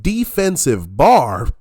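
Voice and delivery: loud, slow, high-pitched disbelief; incredulous echo. Real, not acted. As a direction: throw it back in disbelief, loudly and slowly. Incredulous echo of the played clip.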